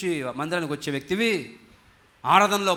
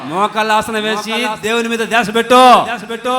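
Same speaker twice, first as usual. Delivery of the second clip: raised, high preaching cry; loud exhortation; 4 semitones higher, 10 dB louder than usual. Real, not acted. Preacher urges congregation to focus on God, loud exhortation. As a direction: drive the command home at full volume.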